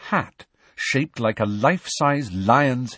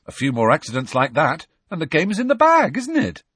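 rising, louder forced brightness; forced cheer; 7 semitones higher, 4 dB louder than usual. A narrator's reading. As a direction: cover the shock with cheer. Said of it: "recovering quickly".

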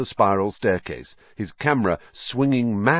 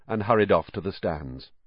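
plain reading voice

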